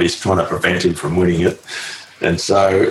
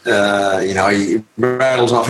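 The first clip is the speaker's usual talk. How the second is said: ordinary delivery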